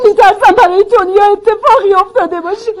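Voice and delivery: anxious and weepy; tearful. Acted. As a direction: voice a fear through tears.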